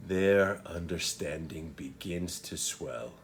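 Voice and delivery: low, soft, trailing verse; hushed Shakespearean recitation. Acted. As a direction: let the speech subside into long pauses. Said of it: "So let me give it a read".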